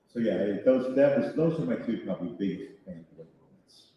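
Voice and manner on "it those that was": ordinary talk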